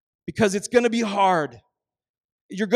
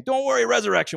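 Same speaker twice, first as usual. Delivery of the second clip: mock glib reassurance; 6 semitones higher, 4 dB louder than usual.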